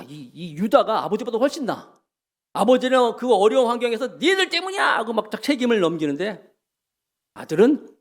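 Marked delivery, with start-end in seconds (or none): 4.2-5.0 blaming father. Retold or imitated. Father blames sons.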